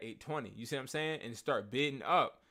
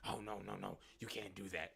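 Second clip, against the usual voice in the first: dismissive mock seller voice; mocking a shady seller; 4 semitones lower, 11 dB quieter than usual. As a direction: brush it off like a scammer. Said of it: "They be like".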